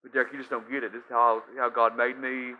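mock complaining listener. Listener protesting to Derek, hammed-up whining. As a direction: whine every excuse to the pastor. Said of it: "imagined objection to the pastor".

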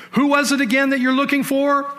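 Jesus asking the fallen soldiers again, loud; loud challenge. Acted. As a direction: throw the question down at them. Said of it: "he looked down and said".

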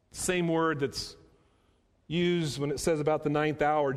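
ordinary talk, nothing marked

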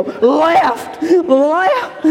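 hammed-up sergeant calling left; drill sergeant call. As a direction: bark the steps at the private. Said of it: "and go".

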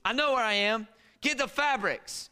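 loud, high, insistent command; sleepy wife insisting. Retold or imitated. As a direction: snap back half-asleep, loud. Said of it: "She goes".

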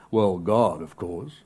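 mock pious, dropping soft; imitating the expected pious answer; pat answer. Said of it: "the pat answer".

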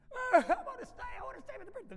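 very high mock tantrum wail; squealed kid's protest, bursting out loud then dropping; screaming, crying kid. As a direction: wail like a child being dragged away. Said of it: "kid starts screaming and crying".

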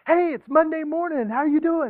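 high, bright mock-cheerful greeting; sing-song Monday cheer; chipper coworker. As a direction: play the annoyingly chipper coworker. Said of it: "they're all chipper".

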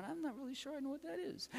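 unsure student voice. Pretend. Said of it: "Right now, you're just going".

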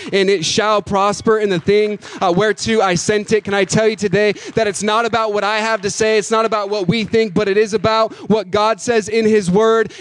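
loud, driving sermon delivery; full-force preaching. Acted. Pastor preaching hard to the congregation, loud. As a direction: preach it out to the whole church.

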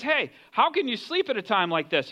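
ordinary delivery

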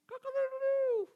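high, sustained mock rooster crow. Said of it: "whatever a rooster does".

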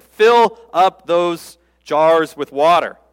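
commanding Jesus. Pretend.

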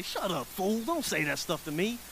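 mock irritation kept under the breath; muttered retort. Worker answering a bothersome coworker, muttered retort. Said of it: "and you wanna say".

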